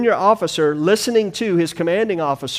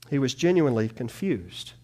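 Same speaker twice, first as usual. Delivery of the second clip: ordinary talk, nothing marked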